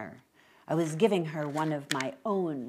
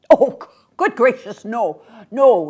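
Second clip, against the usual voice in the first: loud, higher, flustered denial; crying out in protest; 6 semitones higher, 14 dB louder than usual. Acted. Old man denies begging for money, crying out. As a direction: exclaim as if horrified at the very idea. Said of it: "he cried".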